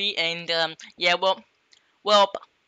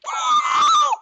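high squeal; 4 dB louder than usual. Pretend. Squeal of panic.

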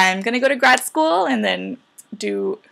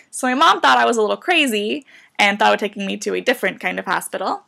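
ordinary talk, nothing marked

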